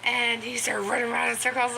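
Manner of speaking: ordinary delivery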